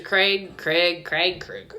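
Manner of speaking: "Craig" repeated with shifting vowels, dropping in pitch; trying out pronunciations. Shown as a demonstration. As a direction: test out different ways to say "Craig". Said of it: "how the fuck do you say Craig in".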